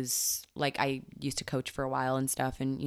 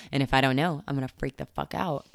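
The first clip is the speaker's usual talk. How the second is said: ordinary delivery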